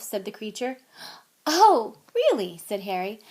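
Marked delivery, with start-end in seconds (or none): 1.5-2.6 loud, slow, startled question; taken aback; surprised. A narrator's reading. Harry surprised.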